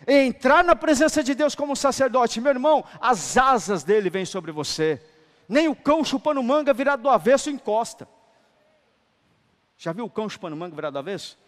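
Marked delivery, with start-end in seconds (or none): 0.1-5.0 loud, high preacher's cry; fiery preaching. Acted. Preacher at full force. 5.5-8.1 loud, high preacher's punchline; shouted punchline. Shown as a demonstration. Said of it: "punchline set against the calm repeat".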